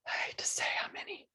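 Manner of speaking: fast, whispered confession; whispered aside. Real, not acted. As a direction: whisper it, a little embarrassed. Embarrassed aside.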